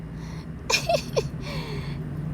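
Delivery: airy giggle; a quick high laugh; high breathy laugh. Real, not acted. Laugh after title.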